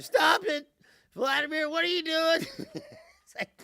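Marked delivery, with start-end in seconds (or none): 0.0-2.5 loud, higher hammed-up scolding; mock scolding post. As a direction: shout it like a frantic social media post. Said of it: "he's on the truth social".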